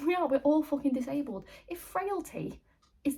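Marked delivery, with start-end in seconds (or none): 0.1-1.4 louder, emphatic outburst; exasperated outburst. Acted. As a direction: burst out in exasperation. Exasperated rant about the man.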